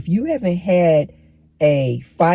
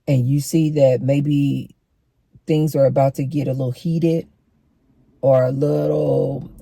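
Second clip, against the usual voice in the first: normal talking voice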